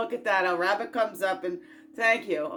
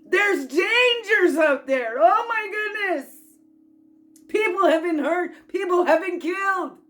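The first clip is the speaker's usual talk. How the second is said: mock fearful worrier; 10 semitones higher, 9 dB louder than usual. Mocking fearful people's voice.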